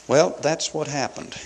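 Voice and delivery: ordinary talk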